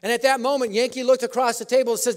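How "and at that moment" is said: normal talking voice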